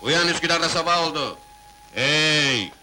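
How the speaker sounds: loud wake-up call. Acted. Waking a sleeping girl.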